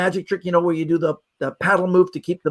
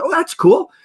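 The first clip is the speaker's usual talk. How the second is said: high-pitched, keen reaction; light up with interest, voice lifting high; 11 semitones higher, 4 dB louder than usual. Retold or imitated. Magician dad hearing about a magic shop, very interested. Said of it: "was very interested".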